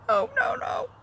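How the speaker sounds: put-on retelling a frantic warning to a toddler; mock panicked no. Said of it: "I'm like".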